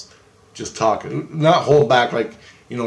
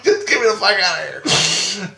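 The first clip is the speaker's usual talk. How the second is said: high-pitched plea through laughter; laughing plea; 8 semitones higher, 4 dB louder than usual. Retold or imitated. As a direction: beg to leave while cracking up. Laughing through own retold plea.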